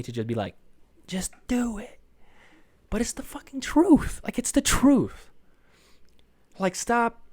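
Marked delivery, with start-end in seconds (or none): 1.1-2.0 whispered, high, strained motivational yell; hushed mock-intense command; Shia LaBeouf. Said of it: "it's so Shia LaBeouf of me". 2.9-7.2 high, loud, worked-up insistence; fired-up pep talk; impassioned insistence. Real, not acted. Worked-up motivational rant after impression.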